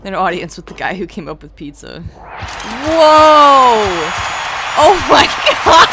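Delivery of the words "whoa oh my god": loud, high whoops of delight; excited shouting. Real, not acted. Streamer thrilled at an adoring crowd, shouting excitedly. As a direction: shout it out, overjoyed and laughing.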